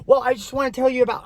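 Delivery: fast, high-pitched ramble; talking over someone. Retold or imitated. Mimicking the date who won't stop, rushed talking-over. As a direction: imitate someone barrelling on past an interruption. Other person talks over her.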